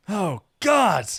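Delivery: loud, high cry of dismay; dismayed exclamation. A narrator's reading. Saphir remembering a forgotten task, dismayed. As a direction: exclaim at a sudden realisation.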